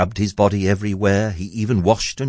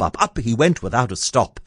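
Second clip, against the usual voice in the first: usual speaking voice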